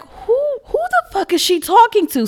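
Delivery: very high, loud bewildered squawk; baffled disbelief. Retold or imitated. Baffled woman turning to her friend about a ranting colleague, high-pitched disbelief.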